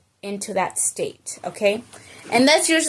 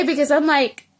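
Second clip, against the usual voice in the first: normal talking voice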